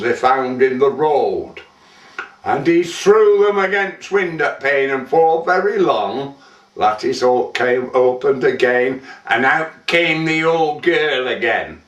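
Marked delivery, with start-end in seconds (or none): none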